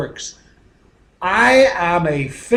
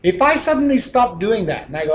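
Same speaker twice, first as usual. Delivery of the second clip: usual speaking voice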